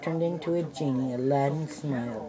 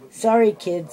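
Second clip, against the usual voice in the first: ordinary delivery, nothing marked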